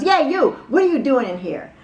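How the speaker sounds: imitated angry yell, high and loud; loud challenge, starting high and easing down; David Miscavige. Church leader confronting a visitor in the library, yelling. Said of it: "So he's yelling like that".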